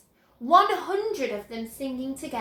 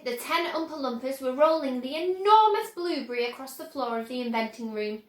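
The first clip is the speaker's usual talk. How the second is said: ordinary delivery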